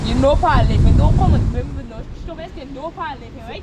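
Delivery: loud, heated demand for money; furious creditor shouting at debtor; angry shouting. Acted. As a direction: yell at him to pay up. Shouting argument over owed money.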